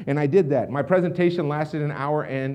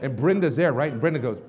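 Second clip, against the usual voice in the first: ordinary talk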